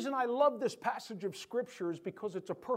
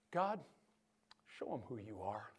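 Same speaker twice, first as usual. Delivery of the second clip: simple quiet prayer; 8 semitones lower, 8 dB quieter than usual. Retold or imitated. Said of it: "Elijah didn't scream".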